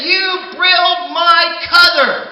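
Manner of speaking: high, loud spoonerized shout; angry pecan log. Acted. Pecan log yelling at the man who flushed his cousin. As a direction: yell it like a furious six-foot pecan log. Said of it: "He said".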